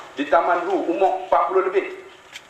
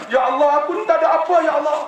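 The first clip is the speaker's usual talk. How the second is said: rushed, higher plea to God; mock contrite prayer; 8 semitones higher, much faster than usual. Preacher voicing a humbled sinner: rushed, higher plea. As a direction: blurt it out like a sudden guilty prayer. Repentant soul crying out to God.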